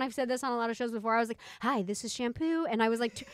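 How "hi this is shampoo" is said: slow, stiff presenter voice; robotic, too professional. Retold or imitated. Imitating her first YouTube videos, stiff and robotic. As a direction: say it woodenly, like an over-rehearsed video intro. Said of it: "very robotic and non-genuine and really weird".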